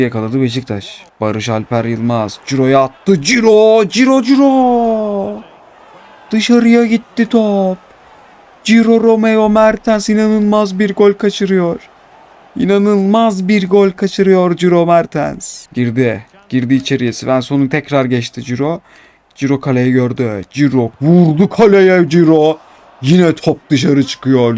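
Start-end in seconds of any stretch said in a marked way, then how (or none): 3.2-15.7 excited yell. Real, not acted. Gamer watching his striker miss, drawn-out excited yelling. Striker misses a big chance. 20.5-24.6 excited call. Real, not acted. Another shot goes wide.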